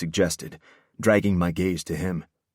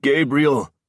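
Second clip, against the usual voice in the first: loud, high yell of a name; urgent raised shout; 7 semitones higher, 5 dB louder than usual. A narrator's reading. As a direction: yell to jolt him awake. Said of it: "Declan yelled".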